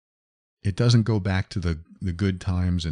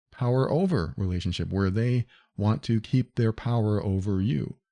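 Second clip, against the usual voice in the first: normal talking voice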